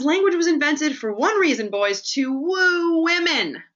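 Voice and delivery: put-on rally the boys with fire; passionate speech. Film teacher rousing the schoolboys, high and loud. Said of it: "he says".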